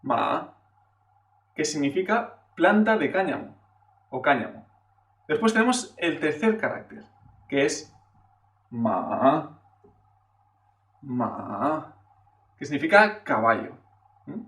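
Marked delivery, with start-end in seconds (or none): 0.0-0.5 "Ma" starting low and rising; second tone. Shown as a demonstration. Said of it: "segundo tono". 8.7-12.0 "ma" dipping low, then rising; low, drawn-out dip-and-rise on "ma"; third tone. Shown as a demonstration. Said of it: "Este es el tercer tono".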